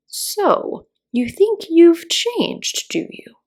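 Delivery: high, slow, drawn-out caterpillar. A narrator's reading. Caterpillar quizzing Alice, slow skeptical drawl. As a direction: draw it out with lofty doubt.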